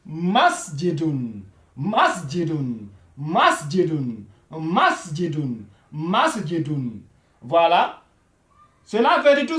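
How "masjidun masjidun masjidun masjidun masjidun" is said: "masjidun" repeated slowly for learners; slow repetition. Shown as a demonstration. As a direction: drill "masjidun" slowly and clearly. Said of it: "Vous voyez?". (« masjidun » répété lentement pour les élèves)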